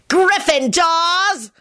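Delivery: high, drawn-out villain cry; scream. A narrator's reading. Scully attacks Neville, screaming. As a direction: scream the name, stretched out. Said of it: "Scully screamed".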